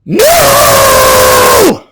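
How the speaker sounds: real wild cry; crazy shout. Real, not acted. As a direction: tear the no out at full strength. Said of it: "my voice so crazy on that one".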